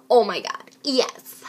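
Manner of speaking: loud, thrilled exclamation; loud excited yes. Acted. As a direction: cheer it out. Excited reaction.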